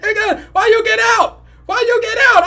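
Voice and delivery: high, loud, frantic shout; panicked yelling. Retold or imitated. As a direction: holler after her in acted panic. Acts out panicked yelling at cousin.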